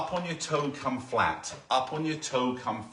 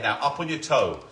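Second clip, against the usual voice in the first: ordinary talk, nothing marked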